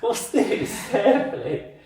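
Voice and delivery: high, loud delighted greeting; excited squeal. Real, not acted. Playful greeting of a friend.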